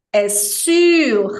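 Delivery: "sur" with pouted lips, I sound, final R; French sur. Shown as a demonstration. Teacher pairs sobre with sur. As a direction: say "sur" round and firm.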